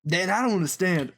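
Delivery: imitating a friend doing the strongman voice; a hint of the dumb jock's odd voice; Noah. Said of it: "Noah using that voice and saying".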